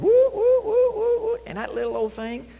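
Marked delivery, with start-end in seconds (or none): 0.0-1.4 high, loud "woo woo" siren; alarm siren wail. Acted. Preacher likens toe pain to a three-alarm fire, siren imitation. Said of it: "everything goes off".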